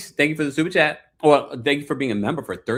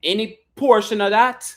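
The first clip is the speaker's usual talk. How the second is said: loud, higher mock Caribbean accent; granddaddy's put-on accent; 6 semitones higher, 4 dB louder than usual. Host as granddaddy haggling over the business, mock Caribbean accent. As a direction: play the grandfather with an imitated island accent. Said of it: "Then Granddaddy's like".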